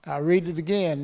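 usual speaking voice

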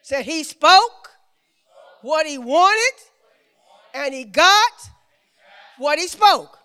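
emphatic preacher, key words punched loud; preaching. Acted. Preacher retells creation to the congregation, preaching emphatically. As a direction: preach it in short, weighted phrases.

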